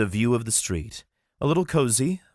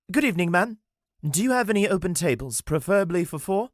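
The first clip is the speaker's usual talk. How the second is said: higher, courteous gentleman voice; polite elf Armand; 11 semitones higher, faster than usual. Acted. Armand politely asking the attendant for a table. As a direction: charm the attendant.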